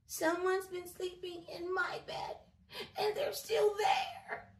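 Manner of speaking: high, loud squeaky cub; crying Baby Bear. A narrator's reading. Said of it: "he cried".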